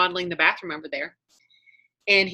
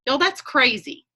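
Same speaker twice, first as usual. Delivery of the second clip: ordinary delivery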